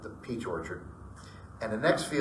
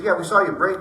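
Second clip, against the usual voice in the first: raised and louder; retold reply; 7 semitones higher, 8 dB louder than usual. Friends telling him they saw him brake, raised voice. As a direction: answer back briskly.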